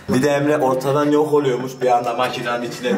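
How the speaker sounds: ordinary talk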